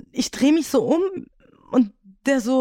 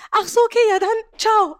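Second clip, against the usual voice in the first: much higher, breezy brush-off; same high voice; 10 semitones higher than usual. Retold or imitated. Salesman shrugging off the rejection, much higher and breezy. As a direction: toss off the goodbye up high. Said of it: "Und er war genauso in dieser Tonlage".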